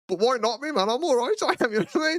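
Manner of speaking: high-pitched, hammed-up protest; mock indignant. Passed-over guy asking what's wrong, mock indignant.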